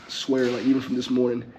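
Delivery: ordinary talk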